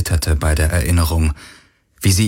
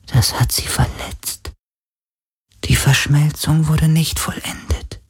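geisterhaftes Flüstern; 6 semitones higher than usual. A narrator's reading.

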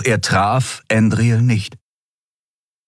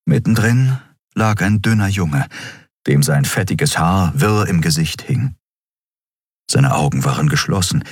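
usual speaking voice

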